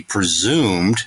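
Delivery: "presumed" stressed and stretched for emphasis. Real, not acted. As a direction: lean hard on "presumed", drawn out. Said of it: "I put the emphasis on presumed".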